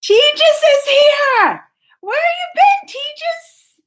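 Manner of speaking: high, loud happy call-out; excited greeting. Real, not acted. Vlogger greeting a returning viewer, high and delighted. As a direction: sing out a welcome to a regular.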